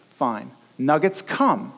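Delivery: normal talking voice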